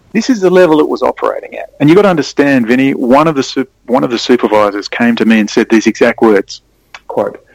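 normal talking voice

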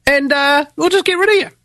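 very high, singled-out mocking voice; high-pitched sarcastic threat; mock schemer. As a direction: play the casual villain in a high parody voice. Voices rulers disposing of resisters.

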